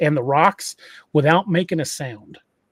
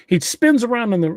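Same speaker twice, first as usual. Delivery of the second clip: ordinary delivery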